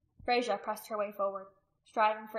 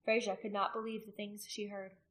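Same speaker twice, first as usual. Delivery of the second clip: ordinary delivery, nothing marked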